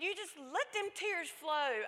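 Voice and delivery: high, slow, fervent mothering; urging encouragement. Retold or imitated. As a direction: give your child full permission, drawn out. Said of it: "I said".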